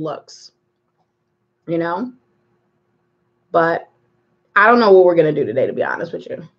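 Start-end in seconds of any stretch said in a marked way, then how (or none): none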